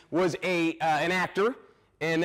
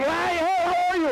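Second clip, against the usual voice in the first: going nuts; 14 semitones higher, 4 dB louder than usual. Retold or imitated. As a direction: wake up a sleepy crowd with a wild greeting. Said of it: "I went nuts".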